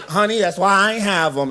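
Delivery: high, lightly camp retort. Retold or imitated. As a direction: put on a sassy camp "honey" voice.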